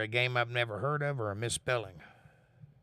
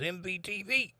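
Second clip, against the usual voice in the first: high put-on voice; 8 semitones higher than usual. Reading Caucasian's message in voice.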